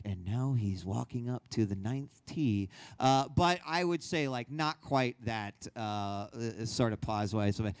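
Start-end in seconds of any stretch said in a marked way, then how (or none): 0.1-2.7 hint of a hushed golf announcer; lightly voiced golf commentary; low, measured golf call; golf commentator. Shown as a demonstration. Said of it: "imagine a golf-esque".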